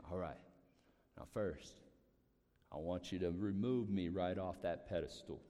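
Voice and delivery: a hint of an older man's low voice; attempted Trotter impression. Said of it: "I can't do Trotter".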